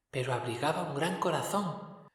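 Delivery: más agudo y suave, voz de espíritu lejano; el fantasma habla quedo y solemne; voz del espectro. A narrator's reading. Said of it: "dijo el espectro". (espectro de Navidad, voz aguda y suave)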